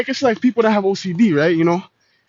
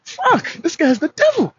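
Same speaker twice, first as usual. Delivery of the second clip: mock exasperation; 8 semitones higher, slower than usual. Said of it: "It's like".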